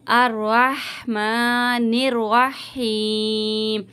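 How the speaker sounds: very slow recitation with ar joined by a zabar; tajweed recitation. Shown as a demonstration. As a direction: recite अर्रहमानिर्रहीम slowly, stretching रहीम. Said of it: "ज़बर लगा के अर पढ़ेंगे". (ज़बर के साथ धीमी तिलावत)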